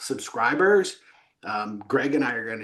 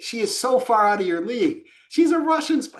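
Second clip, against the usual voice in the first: much higher, louder teasing; mocking disbelief; 10 semitones higher, 4 dB louder than usual. Scoffing at the son's girlfriend story, teasing disbelief. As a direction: rib him, laughing at the idea.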